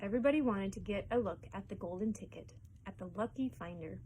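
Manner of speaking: plain reading voice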